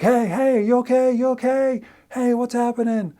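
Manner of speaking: loud, high calls into the dark; calling out loudly. Retold or imitated. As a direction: shout to find the climber. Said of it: "Trying to make noise".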